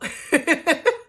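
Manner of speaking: high amused cackle; real laugh after mocking her; high cackling laugh. Real, not acted. Laughing at her own impression.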